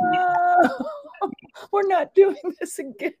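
high, loud, laughing refusal; exasperated yell. Retold or imitated. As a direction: shout it half-laughing in disbelief. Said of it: "And I'm like".

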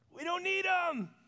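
gloating crowd. Acted. Crowd cheering.

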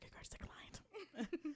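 slow, discreet whisper; soft-spoken. Retold or imitated. Drive-thru man discreetly telling a customer about the card, whispering slowly. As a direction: imitate him breaking bad news in a whisper. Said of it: "soft-spoken gentleman ever".

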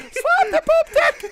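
high mock pirate yell with laughter; squeaky screamed command, laughing. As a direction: scream it from the deck while cracking up. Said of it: "he's screaming".

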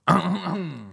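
short high cough; coughing fit. Real, not acted. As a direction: break off into a cough. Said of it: "Batuk mulu".

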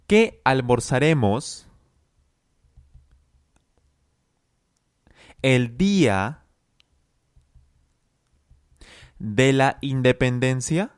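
slow chunked question ending on a rise; teacher drilling a question in chunks; slow repeat-after-me. Shown as a demonstration. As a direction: drill the question slowly, piece by piece. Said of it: "Practique conmigo".

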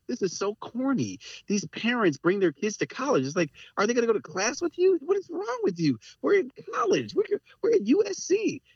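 mockery that climbs steadily to a high pitch; mocking. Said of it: "I remember thinking".

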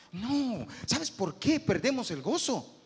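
normal talking voice

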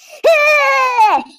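loud, high burst of laughter that breaks into a cry; real loud high laughing yelp; shrieking laugh. Real, not acted. Laughing outburst about stress.

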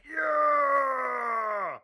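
loud high whoop of triumph; excited cheer. Retold or imitated. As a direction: cheer like you just won. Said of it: "We're like".

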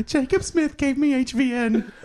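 high-pitched put-on voice; SJ impression. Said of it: "That's an excellent S J impression".

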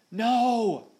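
long, high, loud bellow. A narrator's reading. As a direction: bellow in desperate protest. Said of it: "bellowed Stash".

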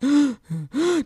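sharp high mock gasp of panic. Co-host acting out panic at the crowded map, mock gasp. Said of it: "And I was like".

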